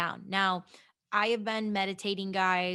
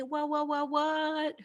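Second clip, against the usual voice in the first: mock surprise; 7 semitones higher, slower than usual. Excited reaction to Harry Potter.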